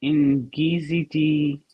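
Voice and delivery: Ngizidi said very slowly, syllable by syllable; slow careful repeat. Shown as a demonstration. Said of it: "Ngizidi, yes?".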